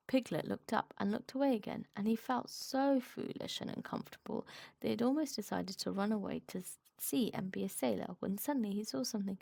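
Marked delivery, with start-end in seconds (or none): none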